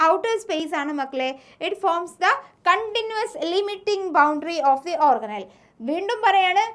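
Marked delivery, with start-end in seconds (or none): 1.7-5.5 slow, deliberate textbook dictation; teacher dictating notes slowly. Shown as a demonstration. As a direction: read it out word by word for notes.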